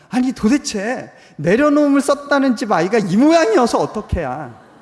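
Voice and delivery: loud, high exasperated outburst; wife's exasperation. Retold or imitated. Wife venting anger over the child's behavior, loud and high. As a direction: blurt it out in frustrated disbelief. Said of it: "어떤 생각이 들었기 때문이냐면".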